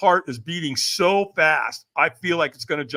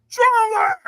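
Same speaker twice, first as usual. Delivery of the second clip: high, loud scream; screaming coach; 7 dB louder than usual. Retold or imitated. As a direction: scream him into a peak state. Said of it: "he screamed at me".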